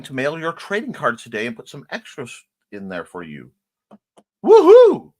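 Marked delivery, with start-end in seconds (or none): 4.4-5.1 high, loud whoop; delighted woohoo; big cheer. Real, not acted. Cheering a viewer's gift.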